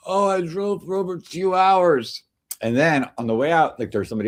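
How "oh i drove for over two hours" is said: imitating old man. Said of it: "he's like".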